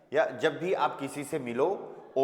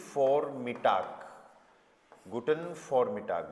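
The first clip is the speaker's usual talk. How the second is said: "guten vormittag" drawn out slowly, V said as F; slow careful demo; 3 semitones lower, much slower than usual. Shown as a demonstration. Teacher to students, slow careful German demo. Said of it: "वी को फे sound करते हैं".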